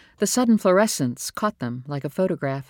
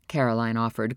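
ordinary delivery, nothing marked

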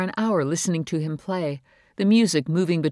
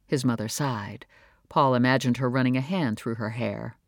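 plain reading voice

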